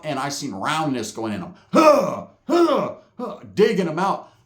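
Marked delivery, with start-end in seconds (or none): 1.7-3.4 sharp, loud effort grunts; high, forceful huh huh huh; stabbing grunts. Retold or imitated. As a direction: grunt with each thrust. Imitates the stabbing thrusts.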